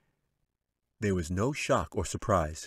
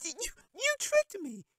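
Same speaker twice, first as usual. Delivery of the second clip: put-on high little girl voice, slow and sputtering. A narrator's reading. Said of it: "spitting and sputtering".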